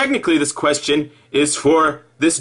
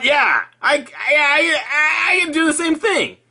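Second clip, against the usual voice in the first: indignant boast; 9 semitones higher than usual. Acted.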